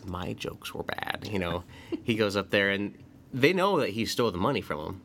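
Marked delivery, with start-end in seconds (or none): 0.1-1.3 slow, quiet villain quote; soft, drawn-out mock villain; Joker. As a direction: lightly imitate a villain, slow and hushed. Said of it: "he comes in, he's cackling".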